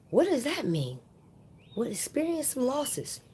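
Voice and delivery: high, hushed bafflement; puzzled questioning. Retold or imitated. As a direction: puzzle it over in a small, high voice. Baffled reply to inner voice.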